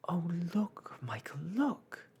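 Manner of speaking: high, urgent excitement; excited cry. A narrator's reading. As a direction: cry out eagerly to point something out. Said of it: "Jane cried excitedly".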